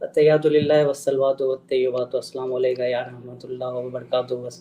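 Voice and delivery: soft, low recitation fading away; low-volume recitation. Real, not acted. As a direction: recite quietly, barely audible to the listeners. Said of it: "आवाज बहुत slow है". (धीमी आवाज़ में तिलावत)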